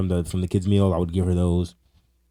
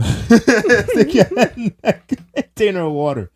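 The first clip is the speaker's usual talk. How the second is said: high, slow, laughing command; high giggly mock order; 13 semitones higher, 7 dB louder, slower than usual. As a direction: laugh through the dare. Friend mocking a dare, laughing.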